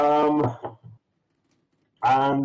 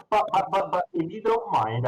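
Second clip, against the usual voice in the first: higher, hesitant lady voice; shopkeeper lady; 8 semitones higher than usual. Acted. Shop lady politely asks them off the wares, higher. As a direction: ease into asking politely.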